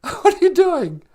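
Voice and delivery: high, loud laughing disbelief. Real, not acted. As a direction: laugh it out in teasing surprise. Listener laughing at choked-up friend.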